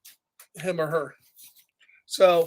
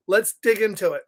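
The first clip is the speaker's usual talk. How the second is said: usual speaking voice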